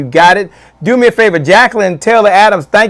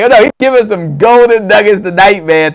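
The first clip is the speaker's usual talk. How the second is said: laughing mock quote; 3 semitones higher, 4 dB louder than usual. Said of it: "She's like".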